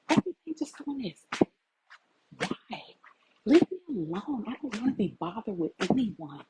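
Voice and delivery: normal talking voice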